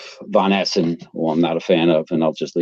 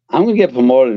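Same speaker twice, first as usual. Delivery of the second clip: mild mock yell, higher pitch; worked-up, raised-pitch inner rant; retold screaming; 5 semitones higher than usual. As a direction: replay an agitated thought without really shouting. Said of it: "as I'm screaming".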